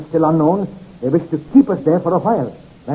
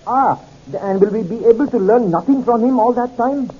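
higher-pitched voice of an anxious questioner; concerned questioner; 7 semitones higher than usual. Acted. Gershard asking about the drugged passenger, concerned questioning. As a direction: press the question in a raised pitch.

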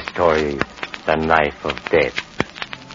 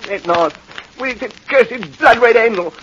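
frantic desperate plea; 7 dB louder than usual. Acted. Killer's frantic confession.

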